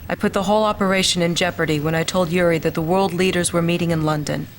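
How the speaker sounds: loud, earnest apology; a shamed officer resigning before his commander. Acted. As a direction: own the failure out loud. Lieutenant confesses his failure.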